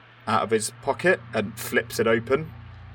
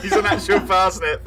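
laughing through it; giggling out the words; 9 semitones higher, 5 dB louder than usual. Real, not acted. Laughing at the joke.

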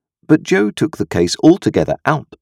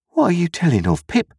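amazed cry; 12 semitones higher than usual. A narrator's reading. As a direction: ask in amazement, pitch shooting up. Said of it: "falling back in the greatest amazement".

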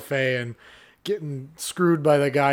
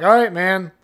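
put-on loud, careless drunk customer; a hammed-up drunk guest at the door; mock drunk patron; 7 dB louder than usual. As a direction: beg like you're blackout drunk.

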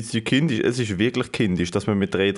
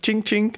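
ching ching; 11 semitones higher than usual. Retold or imitated. Cash register sound joke.

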